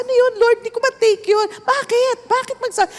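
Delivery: high incredulous questioning; incredulous protest. Retold or imitated. Pastor retelling her disbelief at the command to submit, high incredulous questioning. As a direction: ask God in disbelief, pitched high.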